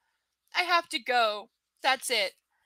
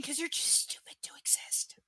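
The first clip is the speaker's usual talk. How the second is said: whispered insult; 3 semitones higher, 10 dB quieter than usual. Real, not acted. Muttered insult at character.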